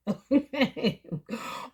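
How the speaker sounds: a quick amused chuckle; short knowing laugh. Real, not acted. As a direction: laugh at the inside joke. Laugh after joke.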